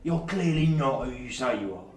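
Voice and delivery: loud, lightly voiced hobgoblin order; gruff hobgoblin. Hobgoblin ordering a supposed inspector out, gruff. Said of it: "a gruff roi-, voice telling someone to get out".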